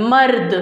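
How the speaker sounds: मर्द as one closed syllable; joined jazm reading. Shown as a demonstration. Teacher repeats the result for class, मर्द blended. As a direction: blend म and र, then a still द in मर्द. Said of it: "मिलाकर साकिन किया है".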